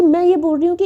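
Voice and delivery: high, loud heated start. Acted. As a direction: push your point before she cuts in. Preet starts shouting, cut off.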